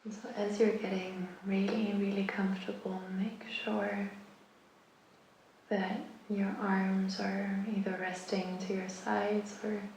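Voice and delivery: unhurried meditation guidance, long pauses between phrases; guided meditation. Acted. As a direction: guide them gradually into rest, phrase by phrase. Guides listeners into rest.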